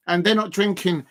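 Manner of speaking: usual speaking voice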